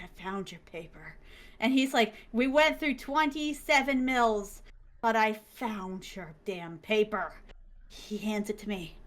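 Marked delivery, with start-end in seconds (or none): 0.0-1.1 low, very soft voice; quiet, low imitation of the caller; hushed announcement. Printer's call back acted out. 2.3-7.3 slow, drawn-out delivery; slow, weighty imitation of the printer; weary triumph. Printer stresses his long search.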